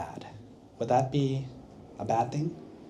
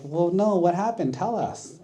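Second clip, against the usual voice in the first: put-on higher, innocent girl voice; innocent young woman; 7 semitones higher than usual. Young woman innocently asking her teachers, higher and sweet. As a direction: ask sweetly as if clueless. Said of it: "and she's like".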